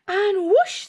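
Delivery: whoosh swooping up high; excited whoosh. A narrator's reading. Narrator to child listeners, a soaring whoosh. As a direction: launch the broom with a soaring whoosh.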